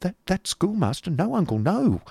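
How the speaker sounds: put-on high, stammering young woman's refusal; shrinking back. A narrator's reading. Niece refusing the introduction, shrinking back. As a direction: recoil with a high, lilting no. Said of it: "shrinking back".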